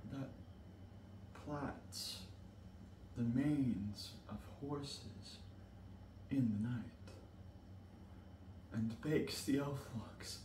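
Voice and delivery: soft, halting, spaced-out phrases; hushed night tale. Acted. Mercutio telling of Mab's night work, hushed and halting. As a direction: drop to a hush after the outburst. Soldier sleeps, Mab works at night.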